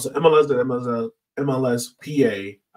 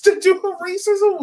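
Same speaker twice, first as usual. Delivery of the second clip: laughing; 5 dB louder than usual. Real, not acted. Laughing disbelief at racism.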